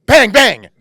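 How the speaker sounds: loud, high shouted bangs; punchy gunfire imitation; imitated gunshots. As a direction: shout it like two shots going off.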